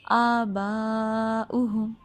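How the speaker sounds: slow chanted "آباؤہم" with the second aa held long; long madd. Shown as a demonstration. Teacher modelling "آباؤہم", long sustained madd. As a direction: stretch "با" in "آباؤہم" well out. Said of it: "چار یا پانچ second لمبا".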